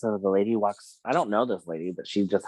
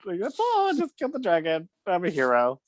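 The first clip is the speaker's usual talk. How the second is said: proud; 11 semitones higher, faster than usual. Retold or imitated. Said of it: "And I was like".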